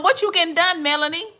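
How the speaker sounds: ordinary delivery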